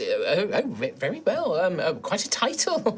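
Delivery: high, twittering grandma; flustered but polite; old lady voice. Acted. DM voicing Madeline to players.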